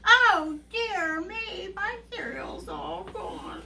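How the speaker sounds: high, slow little bear wail; Baby Bear. Acted. Little bear finding his bowl empty, high mournful wail. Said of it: "Baby Bear looks at his cereal and he goes".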